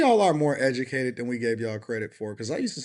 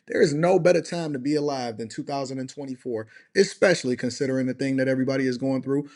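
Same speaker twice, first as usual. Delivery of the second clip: ordinary delivery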